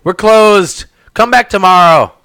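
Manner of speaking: loud, high call from the back room; shouting we're closed. Acted. Shopkeeper shouting at customers who walk in. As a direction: yell out that the shop is closed. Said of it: "a woman's voice, and she says".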